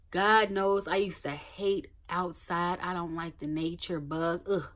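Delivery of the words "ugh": usual speaking voice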